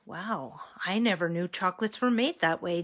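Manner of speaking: usual speaking voice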